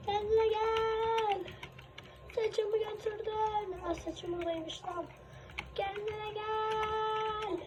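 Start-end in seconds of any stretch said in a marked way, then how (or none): 0.1-1.4 slow, drawn-out, higher coaxing. Acted. Coaxing monster back slowly. 5.8-7.6 very slow, soft, higher plea; very slow coaxing. Acted. Coaxing monster, slower again.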